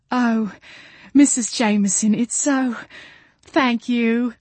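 high, halting, tearful gratitude; sniffling. A narrator's reading. Expectant mother thanks her hostess, sniffling. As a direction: choke up between phrases, overcome with thanks. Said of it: "Jolene sniffled".

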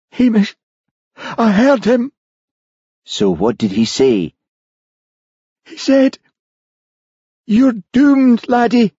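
put-on high, anxious young Scots voice; Charlie, nervous Highland constable. A narrator's reading. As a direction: sound spooked and uneasy. Charlie insists he heard voice.

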